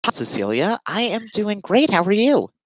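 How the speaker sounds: high, polite customer service voice. Real, not acted. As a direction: greet like a friendly airline attendant. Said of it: "that is what I feel like I'm talking like right now".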